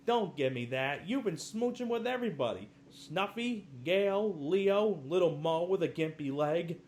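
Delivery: hammed-up scandalized accusation; mock outrage. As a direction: rattle off the names in scandalized shock. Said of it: "Tim Curry goes".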